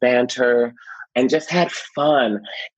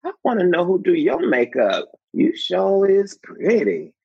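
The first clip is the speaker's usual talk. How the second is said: imitating Loretta; 9 semitones higher than usual. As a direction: savor every word like a doting grandmother. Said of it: "she said".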